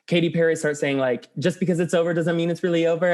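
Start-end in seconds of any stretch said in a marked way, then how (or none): 1.4-3.1 rattled off quickly in one run; rapid-fire lyrics. Retold or imitated. Recalling [name]'s fast lyrics, rapid-fire recitation. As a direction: race through the words without a gap. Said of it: "saying all these lyrics really fast".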